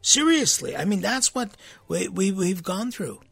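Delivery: ordinary talk, nothing marked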